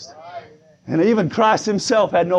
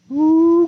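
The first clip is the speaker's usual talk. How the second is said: high, sustained "ooh"; praise whoop; 9 semitones higher, 4 dB louder than usual. Real, not acted. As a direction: whoop as the Spirit moves you. Exclaims in praise while preaching.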